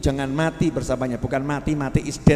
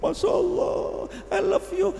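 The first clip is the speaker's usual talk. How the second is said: very high, slow gushing voice; mock swooning wife saying "I love you"; slower than usual. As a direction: melt into a lovestruck squeal. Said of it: "nangis bojone".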